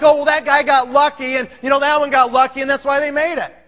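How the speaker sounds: mock excuse. Mocking the lucky-guy excuse.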